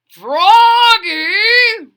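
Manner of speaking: loud high call across the house; father calls his son from afar. Acted. Father calling Froggy.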